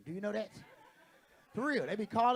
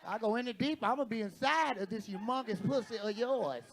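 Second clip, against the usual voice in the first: ordinary talk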